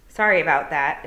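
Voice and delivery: loud, croaky little toad. Acted. Toad apologizing to Tiffany, loud croaky voice.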